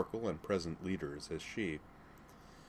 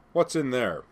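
louder, higher sharp demand; 6 semitones higher, 9 dB louder than usual. A narrator's reading. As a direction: bark the question. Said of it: "he demanded".

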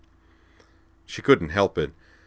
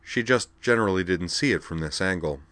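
usual speaking voice

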